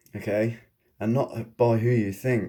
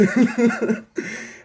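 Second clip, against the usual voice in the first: a high burst of laughter; a real, amused high laugh; 11 semitones higher than usual. Real, not acted. Laugh after the retort.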